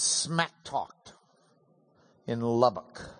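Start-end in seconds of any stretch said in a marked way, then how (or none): none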